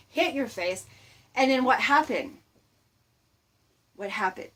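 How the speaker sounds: ordinary talk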